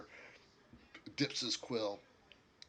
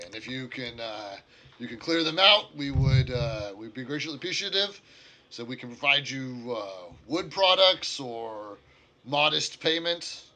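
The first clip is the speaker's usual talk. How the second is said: louder, slightly raised character voice; lightly voiced negotiator; 3 semitones higher, 10 dB louder than usual. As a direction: make the offer in a louder in-game voice.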